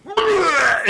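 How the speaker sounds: a high, spluttering mock vomiting noise; imitated retching. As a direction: sputter it out like someone throwing up offstage. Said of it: "I heard".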